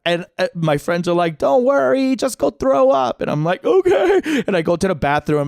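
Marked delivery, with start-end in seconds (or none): 1.4-3.2 high-pitched voice of carefree pals; mock reassuring friends. Friends' advice in put-on voice. 3.6-4.4 very high, helpless agreement; mock panicked okay. Retells panicked agreement while sick.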